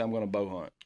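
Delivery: ordinary delivery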